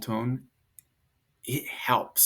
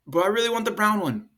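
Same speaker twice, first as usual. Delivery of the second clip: put-on whiny kid; 9 semitones higher, 4 dB louder, faster than usual. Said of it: "They, again, are still".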